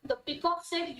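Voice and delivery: ordinary delivery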